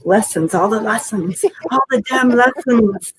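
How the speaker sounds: growing amused exasperation at each "lessons"; building laughing exasperation. Real, not acted. Reading card, building laugh.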